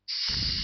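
soft, voiceless shush; long hissed "tsss" for silence; hushing. A narrator's reading. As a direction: hush everyone sharply but softly. Guide hushes the party.

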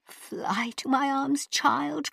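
high, slow, grand motherly cry; Empress crying out. Acted. The Empress calls Ping Cho to her, high and slow. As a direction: open your arms wide in sympathy. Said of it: "cried the Empress".

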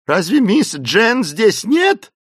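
high, loud, drawn-out question; alarmed cry. A narrator's reading. Esmeralda sitting up, finding Jane missing, crying out. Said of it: "закричала Эсмеральда".

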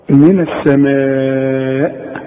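من السماء with the alif stretched very slowly; long madd. Shown as a demonstration. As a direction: hold the madd in السماء four beats. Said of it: "السماء ده مد واجب". (مد واجب طويل في السماء)

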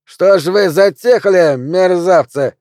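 high, loud, slow holler; rowdy drawn-out yell; shouting at musicians. A narrator's reading. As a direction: berate the band across the room. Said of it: "крикнул он музыкантам".

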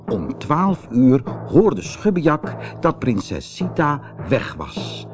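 ordinary delivery

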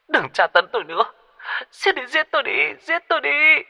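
very high, desperate female wail; sobbing plea. A narrator's reading. Tortured woman repeating her plea into the phone, sobbing. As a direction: keep begging through sobs, pitch climbing sharply. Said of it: "cứ lặp đi lặp lại câu nói".

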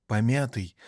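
usual speaking voice

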